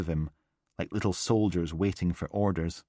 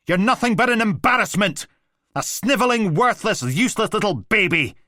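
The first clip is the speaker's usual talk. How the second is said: much higher, louder screaming insults; furious shout; 14 semitones higher, 7 dB louder than usual. A narrator's reading. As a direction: scream it down at the boy. Father screaming insults at boy.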